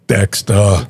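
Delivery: put-on Ukrainian accent. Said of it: "Here's his, uh, Ukrainian accent".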